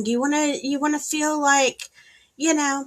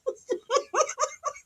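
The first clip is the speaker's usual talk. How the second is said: high laugh; 4 dB quieter than usual. Real, not acted. Laughs after taunt.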